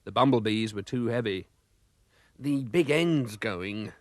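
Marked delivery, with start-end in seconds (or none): 2.4-3.9 very slow, drawn-out doom; slow and gloomy. Acted. Mister Bumblebee grimly diagnosing the engine, very slow. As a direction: drag it out like bad news. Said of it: "said Mister Bumblebee".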